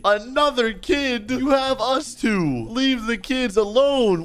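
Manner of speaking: high, slow outraged protest; indignant protest. Real, not acted. Player telling kidnapper to leave kids alone, indignant. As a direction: drag out each word in high-pitched indignation.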